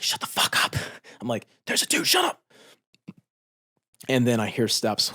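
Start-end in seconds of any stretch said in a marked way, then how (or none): 0.0-0.8 hissed warning with no voice; urgent whisper. Retold or imitated. Soldier hushing his team as an enemy walks by, whispered. 1.7-2.4 much higher, strained hushed urgency; tense warning. Retold or imitated. Soldier warning teammates of an enemy outside, high and tense.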